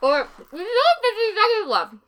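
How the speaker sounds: talking through dry crackers; mouth full. Real, not acted. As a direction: say it with a mouthful of saltines.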